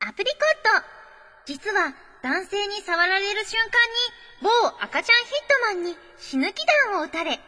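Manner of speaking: high, lively announcer read; dramatic letter reading. Acted. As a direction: read it out like a big reveal. Reads listener letter in character.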